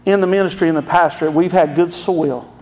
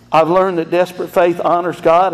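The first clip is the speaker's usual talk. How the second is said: ordinary talk, nothing marked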